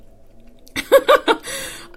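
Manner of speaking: a loud, high real laugh; giggling at her own gag reflex; high burst of laughter. Real, not acted. Laughs at her own beet disgust.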